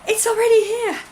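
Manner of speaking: high, loud cry of delight; retelling her joy at the mailman's parcel, exclaiming; thrilled exclamation. Retold or imitated. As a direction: shout it like the parcel just came. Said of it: "and I was like".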